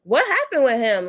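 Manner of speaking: light mock surprise; surprised wondering. Reacting to the Petey post, mildly surprised. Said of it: "I'm like".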